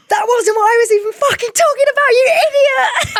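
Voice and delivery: exasperated shout. Retold or imitated. Girlfriend laughing as she angrily yells at her boyfriend. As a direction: blurt it out as a half-laughing furious shout.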